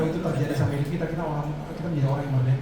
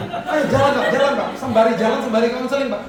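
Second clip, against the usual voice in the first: hurried urging; 7 semitones higher, 4 dB louder than usual. Retold or imitated. Late pastor urging driver on.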